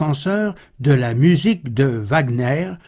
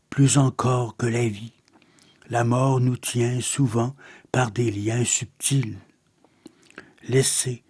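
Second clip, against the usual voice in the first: measured, softer poem reading with long pauses; poetry recitation; 3 semitones lower, 4 dB quieter than usual. Acted. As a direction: recite verse in a lower-key, solemn delivery. (récitation poétique posée)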